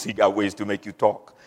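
low, quiet mock-mobster menace; mafia movie heavy. Preacher quoting mafia movies to the congregation, mafia heavy. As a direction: drop it down like a movie gangster. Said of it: "all those mafia movies".

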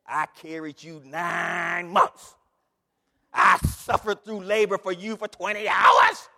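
hammed-up guilt-trip from a mother; guilt-tripping mother. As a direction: lay the guilt on thick. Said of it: "make you feel real guilty".

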